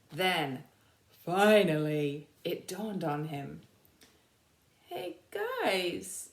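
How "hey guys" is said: slow soldier. Acted. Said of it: "he said slowly".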